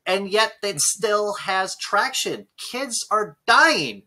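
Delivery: loud, high, slow furious rant; angry rant. Real, not acted. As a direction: hammer each word at anti-vaxxers. Ranting about anti-vaxxers.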